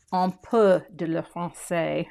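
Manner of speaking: put-on slow schoolbook French accent; self-conscious French accent; clumsy French accent. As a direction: say it in careful, rusty college French accent. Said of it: "I have a terrible French accent".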